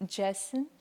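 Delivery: tentative, questioning try at a name; unsure. Real, not acted. Questioner trying the online speaker's name, unsure. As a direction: say the name hesitantly, rising at the end. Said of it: "I'm not sure how to pronounce".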